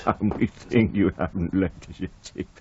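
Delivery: laughing. Acted. Waiter repeats his own joke.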